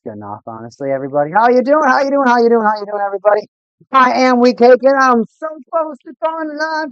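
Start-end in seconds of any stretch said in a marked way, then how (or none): 1.3-3.4 loud, high repeated greeting; hyped greeting. Real, not acted. Greeting chat in loud sing-song. 3.9-4.8 loud, high catchphrase shout; hyped catchphrase. Real, not acted. 4.8-6.9 much higher, silly swooning voice; goofy high voice. Pretend.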